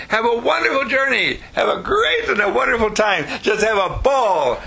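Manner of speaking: high, gushing well-wishing; enthusiastic send-off. Acted. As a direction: send them off with big acted delight. Models the send-off she wants.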